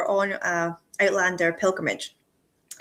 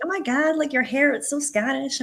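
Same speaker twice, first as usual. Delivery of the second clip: put-on high, gushing American tourist accent, lightly done; a playful, bad impression of an American fan; 6 semitones higher than usual. Quoting the American ladies about her hair, in a bad American accent. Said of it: "that's my really bad American accent".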